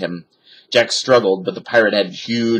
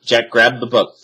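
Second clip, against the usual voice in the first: usual speaking voice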